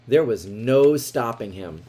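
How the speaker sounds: ordinary delivery, nothing marked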